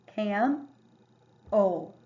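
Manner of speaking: "Cam, o" split into two syllables with a short A; chunked cam-o. Shown as a demonstration. Teacher showing the correct short-A syllable break. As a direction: break it apart as "Cam" then "o". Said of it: "second syllable break would be correct".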